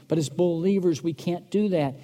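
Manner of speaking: ordinary talk, nothing marked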